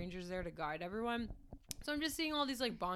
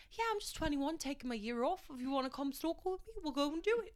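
higher-pitched, badly faked Australian; attempted Australian accent; 7 semitones higher than usual. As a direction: imitate the young Australian guide. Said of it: "I can't do Australia".

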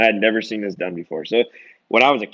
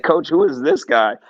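excited call out; 7 semitones higher, 6 dB louder than usual. Retold or imitated.

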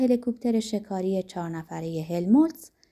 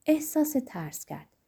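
ordinary delivery, nothing marked

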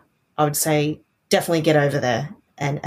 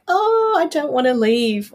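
wistful excitement; 9 semitones higher, slower than usual. Retold or imitated. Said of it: "I was like".

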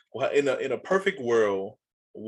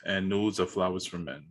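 low, quiet deadpan boast; 6 semitones lower, 4 dB quieter than usual. Pretend. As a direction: say it like an ad pitch. Jokey deadpan manifesto.

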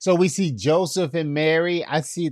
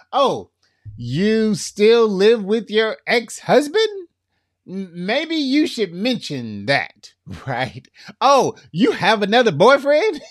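mock shock; 8 semitones higher, 5 dB louder than usual. Mocks hidden partner secrets.

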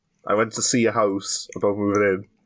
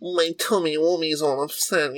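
put-on high babyish whine; baby talk; 6 semitones higher, slower than usual. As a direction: say it like a sulking toddler. Baby talk about stomach.

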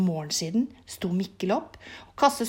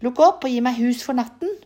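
louder storybook call at the door; 3 semitones higher, 7 dB louder than usual. A narrator's reading. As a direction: call to be let in, raised but controlled. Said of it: "og ropte".

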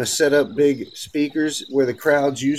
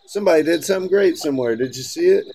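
normal talking voice

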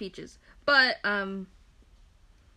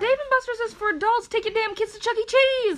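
high, loud indignant rant; mock outrage; 11 semitones higher, 5 dB louder than usual. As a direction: scold absent parents, rising to a yell. Co-host ranting at absent parents.

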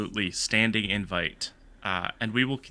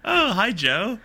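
mock greeting; 9 semitones higher, 3 dB louder, slower than usual. Greeting the joke character.

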